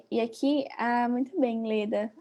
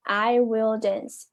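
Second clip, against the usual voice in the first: slow example sentence; 3 dB louder, much slower than usual. Shown as a demonstration. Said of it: "Pode mostrar que o próximo verbo".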